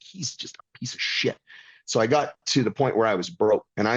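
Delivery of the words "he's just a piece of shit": muttered mad aside. Retold or imitated. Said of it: "I was mad".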